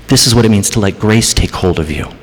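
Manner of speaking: normal talking voice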